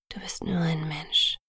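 low, soft, forgiving whisper. A narrator's reading. Boyfriend excuses her human weakness, whispering. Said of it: "flüsterte er".

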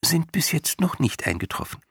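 high, small chirpy voice; a tiny being explaining politely; tiny creature voice. A narrator's reading. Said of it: "erklärte der Winzling".

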